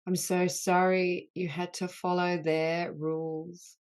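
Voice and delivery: healing litany. Acted. Leader voicing apologies to the wounded inner child, earnest and grave. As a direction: lead the group through each sorry with tender gravity.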